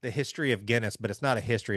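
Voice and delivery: normal talking voice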